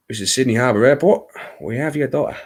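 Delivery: brisk Aussie announcement, accent slipping; Australian accent. Shown as a demonstration. Streamer demonstrating with an airport call, Australian accent. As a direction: put on a quick Australian accent. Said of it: "if you do an Australian accent, you say".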